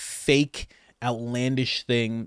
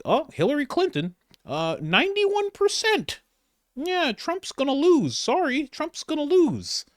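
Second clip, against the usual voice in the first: mock pundit. Mocking the media's poll predictions in a very high squeaky voice. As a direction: squeak it out like a smug newsreader. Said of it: "they literally were like".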